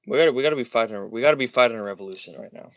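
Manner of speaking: fast, loud rallying call; rally the troops, half joking; mock rallying cry. Streamer joking to chat, a fast loud mock rallying call.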